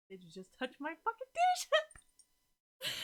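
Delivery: mock outrage. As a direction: act scandalized that someone touched your dish. Indignant about someone touching dishes.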